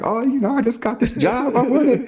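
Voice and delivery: high, starry-eyed rookie voice; eager rookie walks into the academy, high and naive; naive eager recruit. Retold or imitated. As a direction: play the green recruit full of hope.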